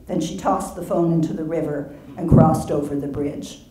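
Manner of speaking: usual speaking voice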